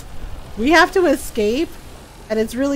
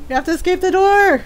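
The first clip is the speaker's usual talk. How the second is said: panicked; 7 semitones higher, 4 dB louder, faster than usual. Real, not acted. Panicking in the game.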